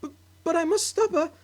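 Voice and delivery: high, loud stammering wail. Acted. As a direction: wail it in despair. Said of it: "Missus Cranky wailed".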